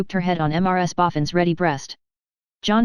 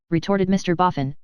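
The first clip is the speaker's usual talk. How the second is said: plain reading voice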